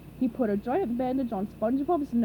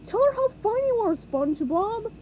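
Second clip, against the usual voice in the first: urgent Patrick; 11 semitones higher, 5 dB louder than usual. A narrator's reading.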